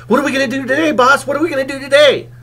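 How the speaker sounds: high, fast squeaky little dog voice; yappy cartoon sidekick dog. Little sidekick dog pestering the big dog, high eager yapping. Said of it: "a little dog walking beside him going".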